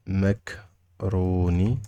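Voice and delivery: "Macaroni" drawn out very slowly in parts; syllable by syllable. Shown as a demonstration. Teacher showing learners the word, syllable by syllable. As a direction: stretch and split "Macaroni" for learners.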